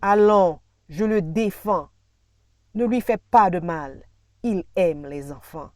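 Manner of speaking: loud, emphatic defence of someone; acted outcry, easing at the end; protective rebuke. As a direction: step in firmly at full voice. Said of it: "Mais une voix réplique".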